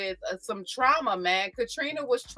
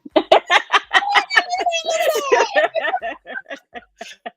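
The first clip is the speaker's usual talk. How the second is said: screaming laugh; 5 dB louder than usual. Real, not acted. Hysterical laughter at flirt voice.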